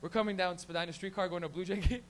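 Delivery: ordinary delivery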